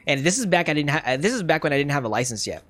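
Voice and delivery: ordinary talk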